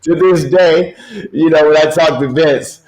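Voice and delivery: laughing through the words; giggling while talking. Real, not acted.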